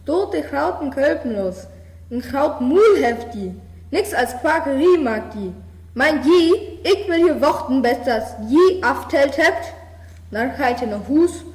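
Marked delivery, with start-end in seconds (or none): 0.1-9.7 furious scolding. A narrator's reading. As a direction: yell at the frogs till they count. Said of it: "schimpft die Bur". (wütend laut schimpfender Bauer)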